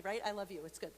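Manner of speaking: ordinary talk, nothing marked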